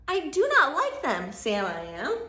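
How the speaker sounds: loud, punchy, falling phrases; emphatic storybook refusal. Acted. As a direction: refuse firmly, hammering every phrase.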